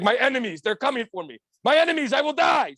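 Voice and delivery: put-on a frantic villain with a Middle Eastern accent; panicked Middle Eastern accent. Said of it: "the Middle Eastern accent when there's, like, a fucking bad guy".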